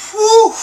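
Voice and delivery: loud, high whoosh of relief; relieved whew. Real, not acted. Telling how the town survived, a loud relieved whew. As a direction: blow out the relief loudly. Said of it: "Oh my god".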